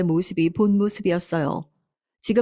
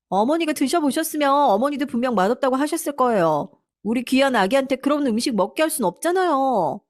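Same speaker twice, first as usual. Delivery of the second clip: high, self-justifying whine; defiant daughter-in-law; 6 semitones higher than usual. Acted. Daughter-in-law defending herself to mother-in-law, high mildly defiant complaint. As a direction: talk back in a higher, lightly acted voice.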